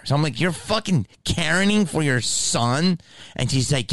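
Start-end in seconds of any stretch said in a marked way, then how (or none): 0.4-3.0 high, slow, exasperated question; retold disbelief, drawn out and pitched up; incredulous challenge. Challenging a mother defending her son, incredulous.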